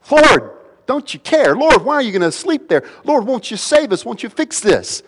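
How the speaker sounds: high, urgent cry to the Lord; panicked plea. Acted. Panicking disciples to a sleeping Lord in the storm, panicked high plea. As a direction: plead in a panic, pitch leaping up. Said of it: "And they're panicking".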